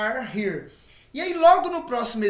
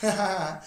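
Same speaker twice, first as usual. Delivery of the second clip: short laugh; 3 semitones lower than usual. Real, not acted. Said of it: "Não é isso".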